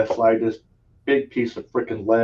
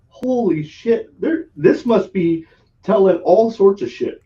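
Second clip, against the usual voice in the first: slow, higher, half-laughing holy shit; mock shocked realization; 8 semitones higher, 5 dB louder, slower than usual. Realizing strangers can see his pain, higher and slow. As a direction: play up the dawning surprise.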